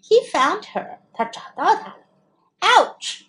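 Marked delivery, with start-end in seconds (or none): 2.6-3.2 high, loud yelp of pain; sharp high ouch. A narrator's reading. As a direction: yelp as if pricked by a spine. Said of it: "He cried".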